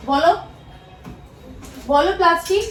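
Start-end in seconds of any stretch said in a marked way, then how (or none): 1.9-2.7 plastic said higher and louder with a rising tune; parent's prompt, plastic lifted high and loud; teaching pronunciation. Shown as a demonstration. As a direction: coax the child to repeat plastic. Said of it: "সবসময় ভুলভাল উচ্চারণ করবে".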